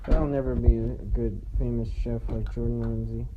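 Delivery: low, soft, trailing self-pity; quiet, deflated confession; dejected musing. Acted. Wistful musing about never being famous.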